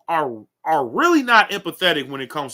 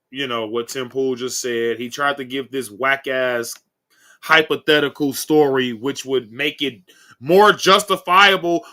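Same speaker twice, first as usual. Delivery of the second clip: ordinary talk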